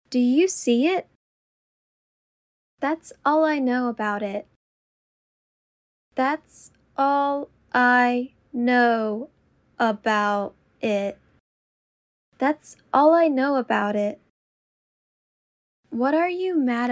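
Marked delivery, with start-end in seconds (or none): 6.1-11.2 very slow and deliberate. Shown as a demonstration. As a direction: draw out every word. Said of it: "repetition drill, slowed take".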